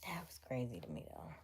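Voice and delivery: soft whispered aside. Real, not acted. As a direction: whisper it to yourself. Closing aside to viewers.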